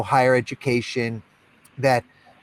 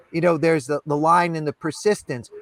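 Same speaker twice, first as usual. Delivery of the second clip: ordinary talk, nothing marked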